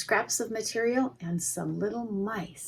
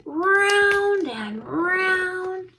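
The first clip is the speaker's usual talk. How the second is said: slow and higher, turning round; drawn out; 11 semitones higher, 4 dB louder, much slower than usual. A narrator's reading. Reader to children, slow higher drawn-out turning. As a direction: stretch it out like the turning.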